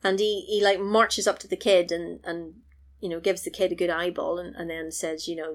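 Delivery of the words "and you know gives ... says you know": ordinary talk, nothing marked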